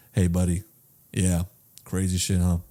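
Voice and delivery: low, soft, uninterested delivery; cold, detached. Retold or imitated. Actor talking to his dog, cold and low. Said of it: "is very cold. It's just very like".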